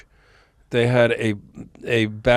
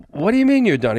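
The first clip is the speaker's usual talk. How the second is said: shocked disbelief; 9 semitones higher, faster than usual. Retold or imitated. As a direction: ask it in shock.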